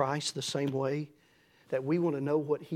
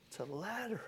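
much softer, hushed wonder; hushed reveal; 2 semitones higher, 15 dB quieter than usual. Retold or imitated. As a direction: drop right down to reveal a treasure.

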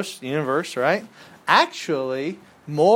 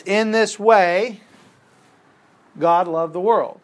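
slow, raised "in this way", then a pause; stress on THIS WAY; 3 semitones higher, 3 dB louder, slower than usual. Shown as a demonstration. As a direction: set "in this way" apart before finishing. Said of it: "that's really not the implication".

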